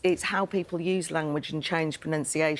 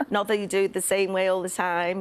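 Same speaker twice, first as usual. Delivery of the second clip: ordinary talk, nothing marked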